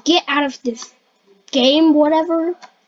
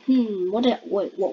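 usual speaking voice

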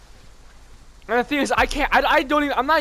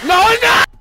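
frustrated shout; 12 semitones higher, 10 dB louder, faster than usual. Real, not acted. Door turns out wrong.

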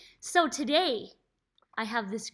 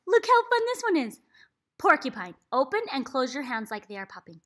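ordinary delivery

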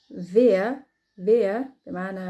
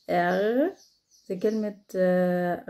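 normal talking voice

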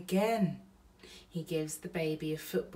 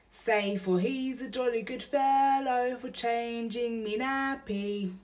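burglar voice; 6 semitones higher, 4 dB louder than usual. Acted. Bill teaches a song line.

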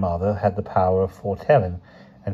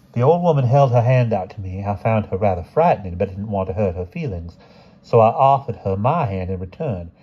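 usual speaking voice

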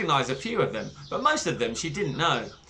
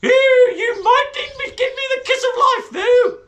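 very high, loud, giggling warble; flirty dotty teacher; 10 dB louder than usual. Acted. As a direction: flirt shamelessly, laughing.

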